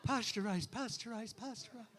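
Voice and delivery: hushed echo, fading away; rolling to the back. Retold or imitated. Comic mimicking the joke spreading to the back, fading murmur. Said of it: "It just kind of rolls to the back".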